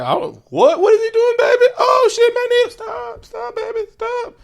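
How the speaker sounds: high squealing panic; panicked falsetto. Retold or imitated. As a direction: shriek it like a frightened diva. Apocalypse voiced panicking at Magneto.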